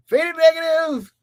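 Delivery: high, loud, drawn-out name call; excited shout-out. Real, not acted. Host hailing the biggest supporter, excited shout.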